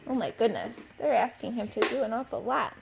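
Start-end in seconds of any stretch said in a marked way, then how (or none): none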